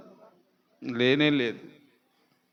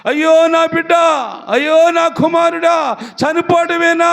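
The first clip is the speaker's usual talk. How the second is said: higher, louder anguished cry for her son; mother's lament; 7 semitones higher, 5 dB louder than usual. Acted. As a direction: wail over the dying child. Preacher voices Hagar's lament.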